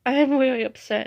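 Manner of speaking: high, loud mock crying; badly faked upset. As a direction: act upset without real tears. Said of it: "I can't fake cry".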